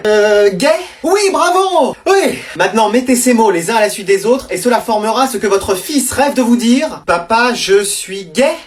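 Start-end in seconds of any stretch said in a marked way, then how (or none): 1.0-2.4 host cheering. Acted. Game show host cheering. 7.1-8.5 slow reveal. Acted. Slow reveal of hidden sentence.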